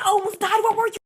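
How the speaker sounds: high, loud retold freak-out; frantic outburst. Daughter to mom back on the phone, frantic and loud.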